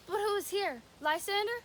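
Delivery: usual speaking voice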